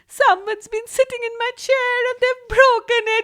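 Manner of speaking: very high, loud, fast little cub; high squeaky Baby Bear. A narrator's reading. Baby Bear voiced in story.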